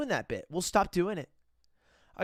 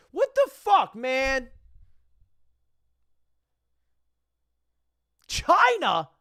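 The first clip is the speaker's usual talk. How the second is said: loud, high outraged disbelief; incredulous shout; 4 semitones higher, 10 dB louder, slower than usual. Real, not acted. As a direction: shout it, utterly baffled. Outraged surprise at rankings.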